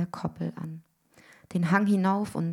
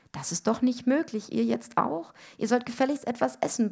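high, indignant mock bellow; mother's yelling; 6 semitones higher than usual. A narrator's reading. Mother berates the thinning sisters, high mock yell. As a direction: yell as Mum, pitched up but contained. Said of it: "brüllte Mutti".

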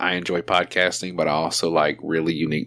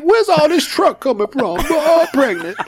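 very high, laughing comic voice; Tracy Morgan impression; 5 dB louder than usual. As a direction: squeak it out, half sung. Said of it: "And I was like".

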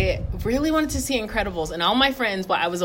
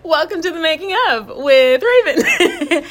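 slower, laughing intro, fighting giggles; laughing through words; 8 semitones higher, 6 dB louder, slower than usual. Real, not acted. Host opening the show, laughing so hard she nearly cries. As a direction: welcome them while trying not to crack up. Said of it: "I'm laughing so hard that I'm trying not to cry".

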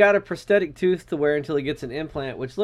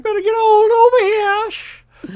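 whispery Family Guy guy; 8 dB louder, much faster than usual. Retold or imitated. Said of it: "the fucking whispery guy from Family Guy".

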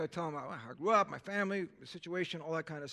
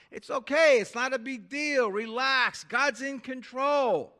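breezy brush-off, slow on the last phrase; brushing her worry aside lightly; mock comforting, loud and high; dismissive reassurance; 5 semitones higher, 5 dB louder, slower than usual. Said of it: "when I'm saying that is, Look, I can't handle your anxiety".

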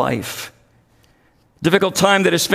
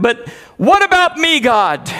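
higher, sudden plea to God; anguished outcry; 6 semitones higher than usual. Retold or imitated. Overburdened pastor asking God for himself, anguished outcry. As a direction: burst upward on "me", then drop back.

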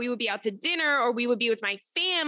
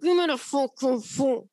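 through gritted teeth. Retold or imitated. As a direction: hiss it through your teeth. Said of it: "talking through your teeth like that".

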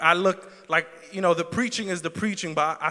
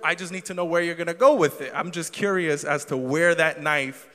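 usual speaking voice

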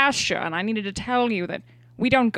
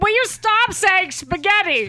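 much higher, louder, slow fed-up cry; exasperated outburst; 10 semitones higher, 4 dB louder than usual. Acted. Father fed up with his wife's spaghetti, loud and high. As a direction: snap at her, at the end of your rope.

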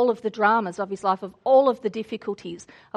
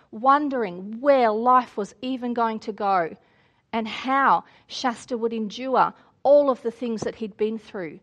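ordinary delivery

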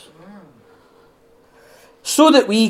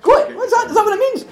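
much higher, baffled questions; puzzled surprise; faster than usual. Retold or imitated. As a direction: act clueless at a new word. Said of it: "and I'm just like".

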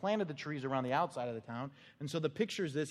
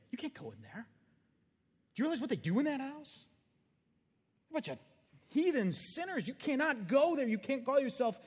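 1 scolding churchgoers; 6 semitones higher than usual. Retold or imitated. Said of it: "That would have been their response today".

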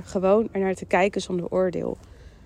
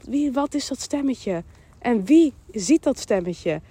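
ordinary talk, nothing marked

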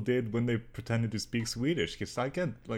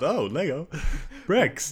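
higher, louder mock call across the room; retelling a shout-out joke, louder and higher; 7 semitones higher, 7 dB louder than usual. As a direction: call it out playfully, pitched up. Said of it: "I just called out".

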